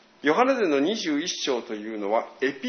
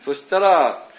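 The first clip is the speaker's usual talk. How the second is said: ordinary talk, nothing marked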